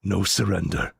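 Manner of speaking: slow, grave warrior declaration; Spartan war talk. Retold or imitated. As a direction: speak like a Spartan king laying down the law. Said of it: "them having a conversation".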